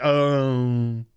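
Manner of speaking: low, deflated oh; low crestfallen oh. Retold or imitated. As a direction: let the air out of him. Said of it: "he's just like".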